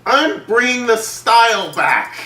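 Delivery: slow, high, loud hammy yell; mock yell. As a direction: bellow it like a pompous villain. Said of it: "He yells everything".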